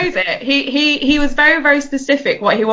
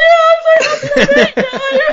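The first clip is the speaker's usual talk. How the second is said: much higher, louder, fast and laughing; giddy overjoyed squeal; 5 dB louder, much faster than usual. Retold or imitated. Player thanking her opponent after winning, squealing with joy. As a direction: gush with tearful joy, barely holding it together. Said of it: "And I was like".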